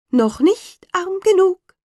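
high, slow, mocking bird voice; taunting sparrow. A narrator's reading. Sparrow mocking the ruined carter, high and slow. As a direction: sneer down at him like a spiteful little bird.

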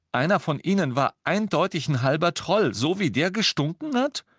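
worked-up, comic aside; animated account. A narrator's reading. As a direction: tell it eagerly as a joke. Ron jokes while telling his story.

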